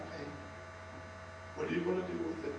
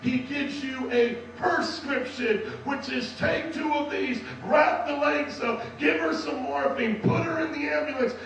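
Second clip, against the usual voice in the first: rising, louder sermon climax, slowing on the last lines; preaching at full force; 5 semitones higher, 6 dB louder than usual. Acted. Preacher calls the church to Jesus, building to a loud roar.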